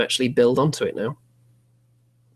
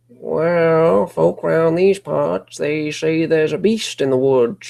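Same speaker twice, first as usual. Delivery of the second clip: higher, louder creepy yokel voice; creepy old local; 5 semitones higher, 6 dB louder, slower than usual. Acted. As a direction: warn them like an old country local. Local fellow warns of beast.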